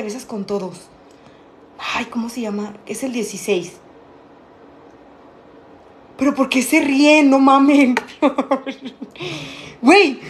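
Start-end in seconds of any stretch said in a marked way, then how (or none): 6.2-10.2 laughing protest. Real, not acted. She asks viewers why they laugh, laughing protest.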